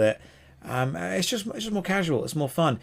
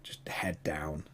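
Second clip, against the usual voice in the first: hushed, worn-out retelling; 9 semitones lower, 14 dB quieter, slower than usual. Retold or imitated. Co-host retelling their silent car ride home, hushed. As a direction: drop to a slow near-whisper.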